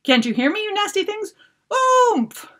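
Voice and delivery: high, loud, crying shouts; desperate shouting. Acted. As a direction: yell the word in panic. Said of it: "Mop was already shouting".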